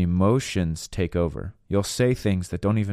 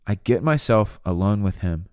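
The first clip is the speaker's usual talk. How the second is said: ordinary delivery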